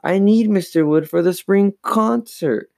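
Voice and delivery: high-pitched, urgent plea; protesting. A narrator's reading. Chris needs the dummy for the concert, protesting. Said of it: "Chris protested".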